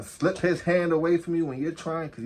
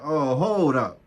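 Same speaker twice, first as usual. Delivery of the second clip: louder, sudden interjection; retold call to stop, pushed out louder; calling a halt; 6 dB louder, slower than usual. Officer stepping between arguing partners, calling a halt.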